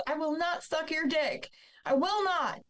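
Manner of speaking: high retold refusal, shrieked in pitch only; retold scream. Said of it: "screaming at the top of my lungs".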